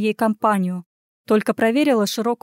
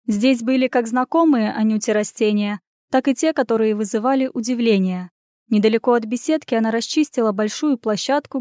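plain reading voice

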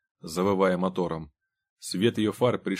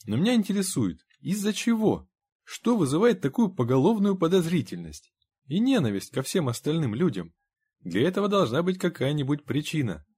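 high-pitched, insistent questioning; placating, curious character voice, pitched high; inquiring visitor Henry; 7 semitones higher than usual. A narrator's reading. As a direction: press for the reason in a raised pitch.

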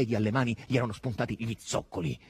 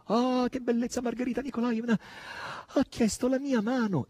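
high, gushing exclamation; excited crying out; 12 semitones higher than usual. A narrator's reading. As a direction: gush in a high, thrilled voice. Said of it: "gridava Natascia". (esclamazione acuta ed entusiasta)

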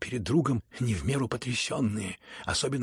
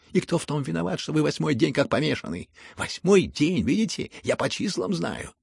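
heated retort; 5 semitones higher, 5 dB louder than usual. A narrator's reading. Son rages back at his father.

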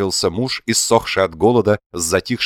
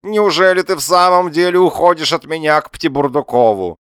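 high, loud theatrical lament; plaintive husband; 10 semitones higher, 5 dB louder than usual. A narrator's reading. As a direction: plead in a high wail. Abandoned husband pleads with wife.

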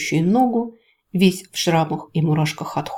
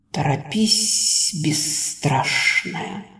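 very slow, hushed great serpent; 3 semitones lower, much slower than usual. A narrator's reading. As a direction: draw each word out like an ancient snake. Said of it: "напомнила себе великий Лэ".

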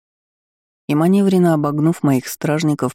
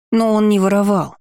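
higher-pitched, mild cry of protest; 6 semitones higher, 3 dB louder than usual. A narrator's reading. Boy defending Lewis to the countess, crying out. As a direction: protest as a child, pitch lifted. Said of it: "закричал мальчуган".

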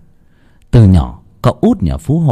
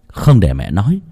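usual speaking voice

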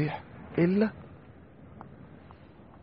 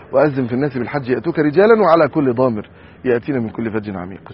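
loud recited verse; Quran recitation; 7 dB louder than usual. Acted. Preacher reciting the Quran verse to the congregation, solemnly intoned.

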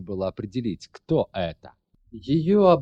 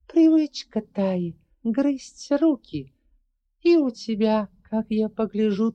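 dry and cold; 12 semitones higher, 4 dB louder than usual. Acted. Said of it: "сухо процедила".

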